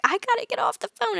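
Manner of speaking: high-pitched, pleading mock urgency; pleading to leave. Caller trying to end a family phone call, mock pleading. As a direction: act out a high plea to escape.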